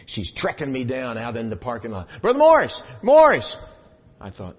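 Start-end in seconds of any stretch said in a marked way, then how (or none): 2.2-3.6 high, loud calling out a name. Retold or imitated. Church lady chasing him down, loud calling out. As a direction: shout across the parking lot.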